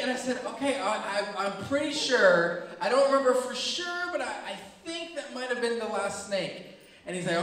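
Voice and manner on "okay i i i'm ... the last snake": higher-pitched, unsure backpedaling; sheepish, tentative placating; hedging reassurance. Retold or imitated. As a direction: stall and hedge as if not quite convinced. Teller sheepishly reassures screaming brother.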